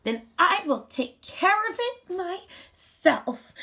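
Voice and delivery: the little hen deciding to do it alone; determined hen. Acted. Hen answers the refusal.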